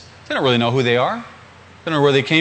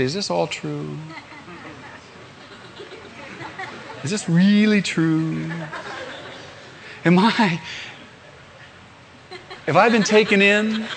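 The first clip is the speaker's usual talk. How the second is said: hesitant questioning with long pauses; wavering doubt; 4 dB quieter than usual. Acted. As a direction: act out wondering whether you were fooled. Acts out a believer's private doubts.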